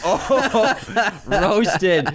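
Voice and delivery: ordinary talk, nothing marked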